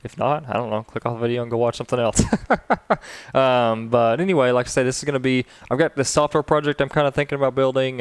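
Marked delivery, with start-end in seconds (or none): 2.3-3.3 short, high chuckle in quick bursts; self-amused laugh. Real, not acted. Vlogger joking to viewers they can click away, self-amused laugh. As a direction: laugh lightly at your own joke. Laughs at own dismissive joke.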